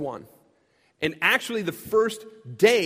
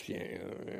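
dismissive aside; 15 dB quieter, faster than usual. Retold or imitated. Muttered aside in quote.

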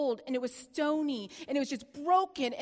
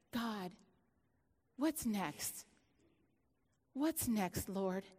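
soft prayer; 4 semitones lower, 6 dB quieter, much slower than usual. Retold or imitated.

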